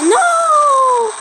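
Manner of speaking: high, long-held cry of no; wailed no. Real, not acted. Gamer yelling at a setback in the match, wailing. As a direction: cry out in dismay, stretching it.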